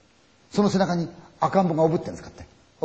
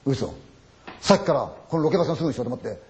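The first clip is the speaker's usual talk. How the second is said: ordinary talk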